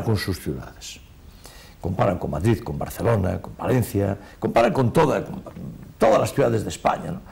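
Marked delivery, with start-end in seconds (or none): none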